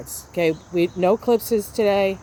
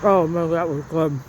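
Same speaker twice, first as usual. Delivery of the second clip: mouth full; 2 semitones lower than usual. Real, not acted. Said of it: "talking with my mouth full".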